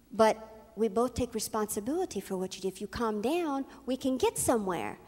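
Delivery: low, soft voice of reason; calm reasoning. Retold or imitated. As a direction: reason gently with someone upset. Reasoning with the upset woman.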